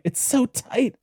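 high, squeezed complaint about the corset; a young lady choking in a tight dress; breathless Elizabeth. Retold or imitated. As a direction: gasp it out like the dress is crushing you. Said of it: "and it's like".

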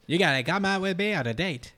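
high-pitched comic suitor; Grant Morrison. Acted. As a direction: ask for a date in a goofy put-on voice. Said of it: "that's gonna be his voice".

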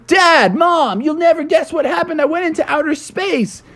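put-on high, breathless kid voice; excited kid. Froggy telling parents about space, high excited kid. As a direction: burst in with big news.

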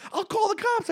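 high frantic mock threat; hammed-up panicking cabbie. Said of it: "He goes".

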